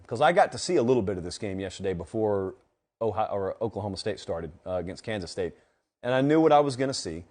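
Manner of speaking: ordinary talk, nothing marked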